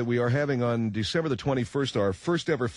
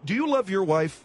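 raised, higher-pitched demand; yelled question; 7 semitones higher than usual. Acted. Host grilling a distressed guest about his marriage, yelled. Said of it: "Please don't yell at me".

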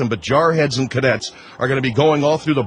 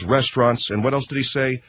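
ordinary delivery

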